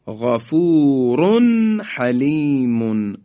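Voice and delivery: slow recitation, nun sounded clearly with no hold; Quranic recitation, clear izhar. Shown as a demonstration. Teacher reciting the correct examples, slow clear izhar. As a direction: recite slowly and pronounce the nun cleanly.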